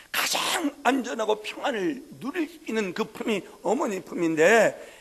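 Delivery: loud, rising proclamation; slow, emphatic sermon voice; preaching. Acted. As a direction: proclaim God's comfort. Preaching God's promise loudly.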